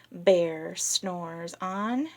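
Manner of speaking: very slow and drawn out; slow cue line. Shown as a demonstration. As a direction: stretch it to invite the snore. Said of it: "whenever we say".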